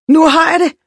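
put-on high, loud girl's exclamation; triumphant shout. A narrator's reading. Red-haired girl suddenly finding the answer, shouting. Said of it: "råbte hun".

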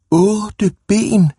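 put-on children shouting it high and loud; shouted. A narrator's reading. Children shouting about the monster, loud and high. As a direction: cry it out in shocked delight. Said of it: "råbte alle børnene".

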